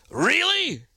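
high, drawn-out disbelieving really; sarcastic sky-high really; incredulous really. Pretend. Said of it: "I hope they heard it in that voice".

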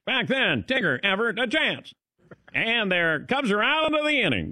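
high-pitched vintage baseball caller; old-time radio announcer. Acted. Host mimicking old baseball radio calls, old-time announcer voice. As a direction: call it like an old radio broadcaster. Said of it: "the radio guy, he would sound like this".

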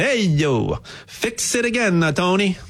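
hammed-up Italian accent, pitch jumping up on fix it again; playful Italian accent, tossed off like a joke. Shown as a demonstration. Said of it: "I'll say it with an Italian accent".

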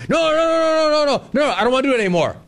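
high, rapid string of refusals; mock-frantic backing out; retold panicked refusal. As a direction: wave it off in a high-pitched protest. Acts out his past refusal.

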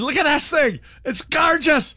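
high, giddy mock Scottish voice; Scottish fan admiring the dashboard, giddy mock voice; giddy Scotsman. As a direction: gush over the dashboard like a thrilled Scot.

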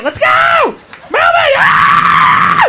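high herding shout ending in a harsh scream; bossy yelling. Acted. Organizer friend herding girls out, bossy screamed orders. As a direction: drive the group out the door.

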